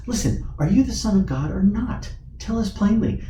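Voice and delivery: acted impatient questioner; insistent interrogation. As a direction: press the prisoner for a straight answer. Caiaphas's acted demand of Jesus.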